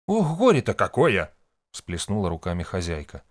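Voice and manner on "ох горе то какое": high, loud wail of woe; dismayed lament. A narrator's reading. Hostess throwing up her hands at bad news, lamenting.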